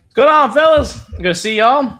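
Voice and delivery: booming, high hello to the guys; loud cheerful greeting. Real, not acted. Producer greeting the hosts on air, loud and high. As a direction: throw a big loud hi at the room.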